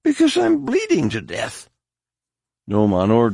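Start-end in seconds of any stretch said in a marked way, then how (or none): 0.0-1.7 much higher, strained plea; a bleeding warrior begging for his life; desperate wounded man. Acted. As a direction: force it out through the pain, high and urgent. Wounded man begs for help.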